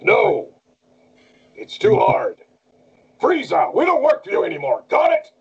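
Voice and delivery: high, strained battle shouting; Bardock. Shown as a demonstration. Asked to do the role for the interviewer, strained shouting. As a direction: shout it like an embattled anime fighter. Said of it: "A lot of shouting with him".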